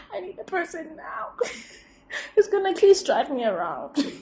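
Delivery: high, laughing and tearful; half laugh, half cry; laughing through tears. Real, not acted.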